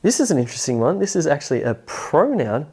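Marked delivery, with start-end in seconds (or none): none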